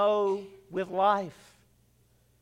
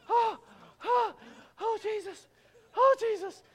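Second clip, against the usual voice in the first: much higher, breathless worried whimpers; anxious whimpers; 11 semitones higher, 4 dB quieter than usual. Retold or imitated. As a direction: whimper nervously on the climb. Imitating Ashley on the ride.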